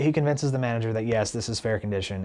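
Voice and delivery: ordinary talk, nothing marked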